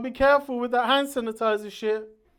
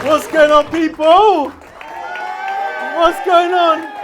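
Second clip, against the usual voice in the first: loud, higher-pitched hype shout; shouting to the crowd; 7 semitones higher, 13 dB louder, slower than usual. Real, not acted. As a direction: fire up the room at full volume. Comedian greets crowd, shouting.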